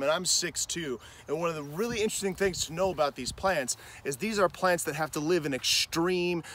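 usual speaking voice

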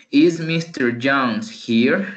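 slow, careful English question; slow model question. Shown as a demonstration. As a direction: say it slowly and clearly. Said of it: "Pregunta alguien". (pregunta en inglés, lenta y clara)

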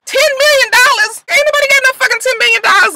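high, loud, fast mock outrage; outraged disbelief. The group hearing Andrew's demand, high outraged. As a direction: scoff at the price.

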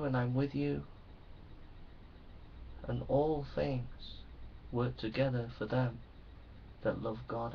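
very soft, low, tender voice of the Saviour; still small voice. Acted. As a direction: hush it down like a gentle whisper of love. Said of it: "the still small voice".

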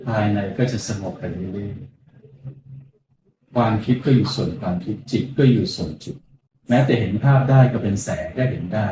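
slow, hushed meditation voice; guided meditation. Acted. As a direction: guide them gently into stillness. Monk leads guided meditation.

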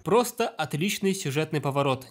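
ordinary talk, nothing marked

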